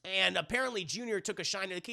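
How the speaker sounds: ordinary talk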